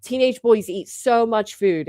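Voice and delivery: ordinary talk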